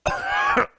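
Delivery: short strained choking cough. Real, not acted. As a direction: cough through a blocked throat. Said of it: "excuse me".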